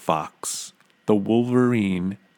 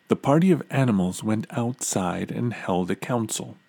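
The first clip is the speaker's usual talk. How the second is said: ordinary delivery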